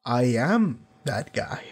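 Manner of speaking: mock tough guy, slow and low; slow menacing line. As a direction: let each word land slowly like a tough guy. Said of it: "slowly turned around and went".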